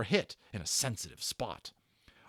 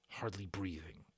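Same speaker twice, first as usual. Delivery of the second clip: ordinary delivery, nothing marked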